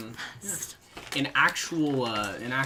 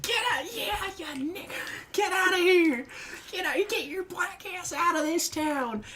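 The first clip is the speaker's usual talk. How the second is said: retold shouting. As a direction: screech it out like a furious bigot. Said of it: "he just starts shouting".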